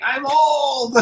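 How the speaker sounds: high, lightly voiced old lady; jokey hint of a frail old woman; mock old lady. Answering people who say act your age, a light old-lady voice. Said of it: "And I'm like".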